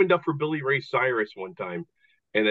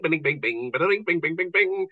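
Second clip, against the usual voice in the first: cartoon bings; 6 semitones higher than usual. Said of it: "It was a bing".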